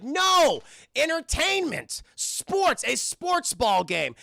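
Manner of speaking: high, loud, enthusiastic pitch; excited insistence. Acted. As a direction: push the idea with big mock excitement. Girlfriend eagerly rejects nap, proposes game.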